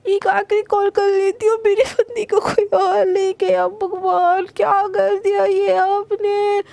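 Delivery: high, tearful wail over a lost friend. A narrator's reading. Priyanka wailing to God over her lost friend, tearful. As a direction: cry it out in despair, voice breaking. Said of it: "ऐसे बोल के ना वो एकदम call लगाती है".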